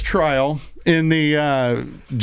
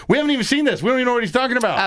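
high, fast mock protest; defense lawyers; 8 semitones higher, much faster than usual. Host retelling the defense's objection, high and fast. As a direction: play the defense lawyers complaining in a rush. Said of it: "the defense is like".

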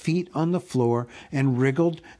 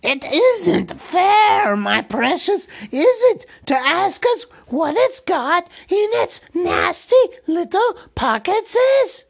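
wheedling Gollum voice; 7 dB louder than usual. A narrator's reading. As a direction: plead like a wretched cave thing with a sob. Gollum voice held in reading.

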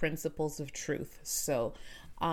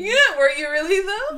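laughing; 13 semitones higher, 13 dB louder than usual. Real, not acted. Mom laughing back.

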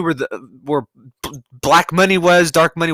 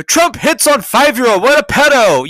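mock news outrage; 8 semitones higher, 4 dB louder than usual. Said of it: "The news would be like".

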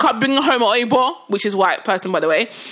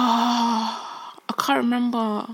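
softer, slow sighing; quiet; 6 dB quieter, much slower than usual. Real, not acted. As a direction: trail off after a sigh. Sighing, forgot the point.

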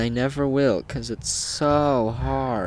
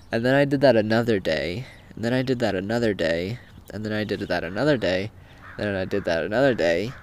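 fast repetition; much faster than usual. Real, not acted. Repeating the same day over and over.

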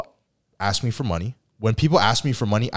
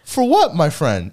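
high, slow, shocked fifty bucks; disbelieving squeak at the sum; incredulous; 13 semitones higher, 4 dB louder, slower than usual. Retold or imitated. Narrator to borrowing friend, loud disbelieving. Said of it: "And I'm like".

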